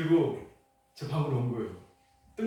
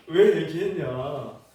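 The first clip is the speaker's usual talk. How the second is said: slow, louder mock reproach; hammed-up scolding of his wife; 3 semitones higher, 9 dB louder, much slower than usual. Husband mock-scolding his wife for telling her parents, slow and louder.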